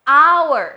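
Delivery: HOUR as "ow-er", high, loud and falling; silent H. Shown as a demonstration. Teacher modelling for students to repeat, silent H. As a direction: punch out "hour" with no H. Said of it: "ไม่อ่านออกเสียง".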